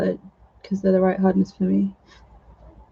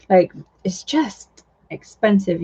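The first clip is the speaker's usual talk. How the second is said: ordinary talk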